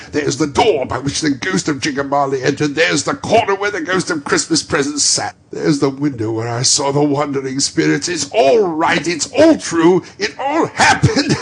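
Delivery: a schoolboy's joy; giddy, merry outburst; giddy delight. Acted. Scrooge excited in his room.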